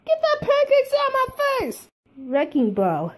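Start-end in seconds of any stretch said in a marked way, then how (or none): 0.1-1.9 very high, loud yell; angry shriek. Acted. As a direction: shriek him away. Pancakes shoved in face.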